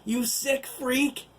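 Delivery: slow, high, strained shout; breathless yell. Acted. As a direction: force it out while struggling to breathe. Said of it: "struggling to breathe".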